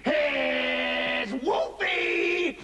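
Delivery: long drawn-out, high shout; menacing wolf announcing himself; wolf's triumphant cry. Acted. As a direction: burst in, stretching each word hugely. Wolf bursts in.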